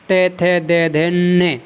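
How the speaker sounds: का, खा, गा, घा, ण recited in a measured row; syllable drill. Shown as a demonstration. Teacher reciting the ट-row to show the nasal at its end. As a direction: chant the row slowly, landing on ण. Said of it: "आप जब इसके साथ इसका उच्चारण करेंगे".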